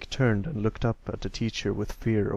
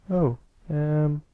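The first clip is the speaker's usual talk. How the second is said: startled; 5 semitones higher, 5 dB louder than usual. Acted. Pipsqueak startled.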